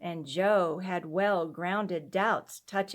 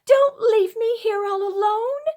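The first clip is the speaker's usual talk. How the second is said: put-on high, loud pleading child's voice; a scared little girl begs not to be left; frightened child; 13 semitones higher, 9 dB louder than usual. A narrator's reading. Sick child begs not to be left alone, high and pleading.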